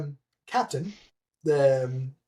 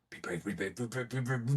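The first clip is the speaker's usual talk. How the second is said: much lower and much softer; muttered self-pep talk; 4 semitones lower, 10 dB quieter than usual. Retold or imitated. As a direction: talk yourself into it under your breath. Self-talk before the cold pool.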